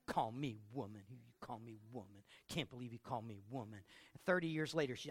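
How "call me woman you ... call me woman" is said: low, soft offended muttering; wife muttering after the quarrel; grumbling. Acted. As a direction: mumble it to herself, still offended.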